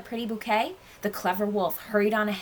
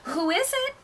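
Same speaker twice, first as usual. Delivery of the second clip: high, sweet sing-out call; wolf faking a dear old granny, pitched way up; sweetest Granny voice; 13 semitones higher than usual. A narrator's reading. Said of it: "in his sweetest Granny voice".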